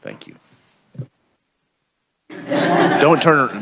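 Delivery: usual speaking voice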